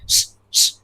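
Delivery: soft voiceless "shh, shh"; shooing hush. Retold or imitated. Father shooing sparrows from under the fan, imitated hushing. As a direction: imitate shooing birds with short hisses. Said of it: "ऐसे करके उन्होंने आवाज दी".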